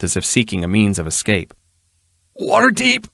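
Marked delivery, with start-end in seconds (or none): 2.4-3.1 high, strained panicked croak. Acted. Ruffian croaks to Erilyn in panic. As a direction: croak it out in fright. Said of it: "he croaked".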